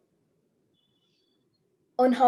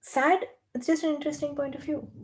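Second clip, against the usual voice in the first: higher, breezy brushing-off of the feeling; light dismissal; 5 semitones higher than usual. Shown as a demonstration. Coach showing how she lightly shrugs off sadness, higher and breezy. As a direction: wave it away lightly. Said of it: "When I say that, I mean it".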